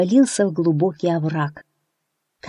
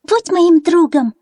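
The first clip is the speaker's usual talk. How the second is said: much higher, slow tiny chick voice; peeping baby chick; 11 semitones higher, 5 dB louder than usual. A narrator's reading. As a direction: peep it like a little chick. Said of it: "цыплёнок Фью и предложил".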